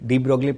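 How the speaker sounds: de Broglie with a sounded g, "dee brog-lee"; mispronunciation. Shown as a demonstration. Teacher rejecting a wrong reading, de Broglie as "dee brog-lee". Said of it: "pronunciation सही नहीं है".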